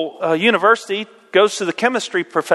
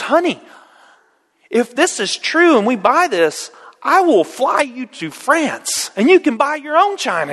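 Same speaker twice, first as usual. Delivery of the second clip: higher, soothing sweet-talk to his wife; coaxing husband; 7 semitones higher than usual. Acted. Jeff promising his wife a trip to France, coaxing and higher. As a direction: win her over with a promise. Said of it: "He goes".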